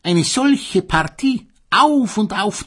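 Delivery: high, loud mock-pitying gossip; gossipy sausage. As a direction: gossip like a catty old neighbour. Selchwurst klatscht über das Paar. (hohe, tratschende Klatschbasenstimme)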